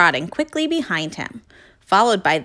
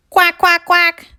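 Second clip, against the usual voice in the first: high, loud duck calls; high loud quacking; calling quacks; 11 semitones higher, 6 dB louder than usual. Acted. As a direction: call out quacking. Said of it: "they called".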